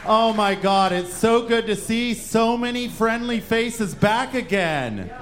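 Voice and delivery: ordinary talk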